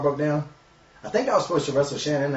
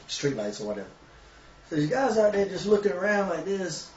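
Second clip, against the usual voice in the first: ordinary talk, nothing marked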